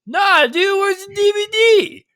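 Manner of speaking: high, loud, drawn-out demand; pestering fan's shout. Acted. Random fan accosts the actor about his DVD, loud shouting. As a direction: yell it like an overexcited heckler.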